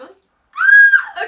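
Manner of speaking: piercing high shriek of joy; excited scream. Real, not acted. Vlogger shrieking at finding a favorite brand in the box. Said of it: "I'm screaming now".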